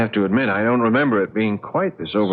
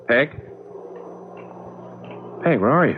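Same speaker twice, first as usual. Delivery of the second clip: worried calling; 6 semitones higher than usual. Acted. Searches for his missing wife.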